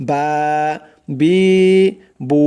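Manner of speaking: বা, বি, বু each vowel stretched one alif long; long madd vowels on বা বি বু; drawn-out vowels. Shown as a demonstration. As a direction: stretch বা বি বু slowly. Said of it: "এরকম টেনে টেনে পড়তে হবে".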